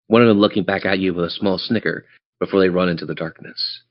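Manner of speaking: ordinary talk, nothing marked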